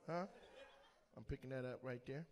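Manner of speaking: ordinary talk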